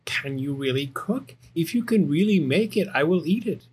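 lightly voiced sceptical cat; doubtful Cat. Cat questioning Little Bear's cooking, lightly voiced and doubtful. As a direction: play a cat unsure of the cook.

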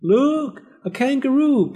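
very high, astonished call; calling from the street. A narrator's reading. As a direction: shout it like excited onlookers. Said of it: "people called from the street".

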